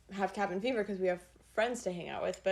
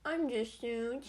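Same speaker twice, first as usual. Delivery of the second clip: slow, weary cartoon drawl; Droopy Dog; 3 semitones higher, slower than usual. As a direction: stretch out the tiredness. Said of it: "Is that your droopy dog?".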